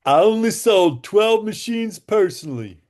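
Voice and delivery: high, loud, slow country-guy voice; Bob Gridelli, country guy. Retold or imitated. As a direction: imitate the country guy, drawing the words out. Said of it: "and he goes".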